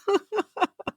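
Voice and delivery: high-pitched giggle. Real, not acted. Host laughing at her old habit, high giggle. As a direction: let a high giggle escape.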